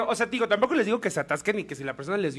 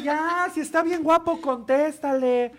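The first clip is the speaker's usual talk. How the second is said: much higher, louder and slow, teasing; teasing drunk friend; 8 semitones higher, 5 dB louder, slower than usual. Retold or imitated. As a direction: egg him on in a high sing-song. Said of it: "el güey". (el amigo borracho animándolo, agudo y lento)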